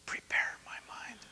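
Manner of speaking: very soft whisper, all breath. Shown as a demonstration. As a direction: lead them in a hushed whisper. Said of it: "We're gonna whisper".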